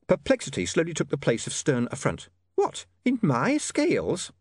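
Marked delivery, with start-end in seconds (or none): 2.5-4.3 slow, high puzzled landlady; a prim landlady baffled; shrill landlady. A narrator's reading. Landlady voiced in story.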